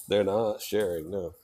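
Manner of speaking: normal talking voice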